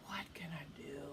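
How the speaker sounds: soft, low whisper to oneself; whispered musing. Retold or imitated. Bored boy alone in his room wondering, whispering. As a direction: whisper it as a private thought.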